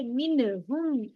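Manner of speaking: मिन हुम with the noon sounded fully, no nasal hold; मिन हुम, crisp noon without ghunna; clear izhar noon. Shown as a demonstration. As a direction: pronounce the न of मिन plainly before हुम. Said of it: "नून पूरा आ रहा है ना, जाहिर हो रहा है".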